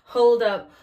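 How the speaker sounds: loud Hold up with falling end. Real, not acted. Loud Hold up as she realises the power is still on. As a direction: stop everything with Hold up. Louder retake correcting herself.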